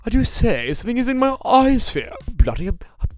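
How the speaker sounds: hammed-up British gentleman exclaiming; mock British. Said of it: "how I imagine how they say eyeballs".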